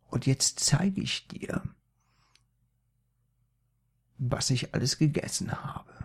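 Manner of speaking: leise, gehauchte Drohung des Opas; flüstere wie ein Märchenungeheuer; gruseliges Flüstern. A narrator's reading. Opa droht gruselig. (Opa droht Maria gruselig flüsternd)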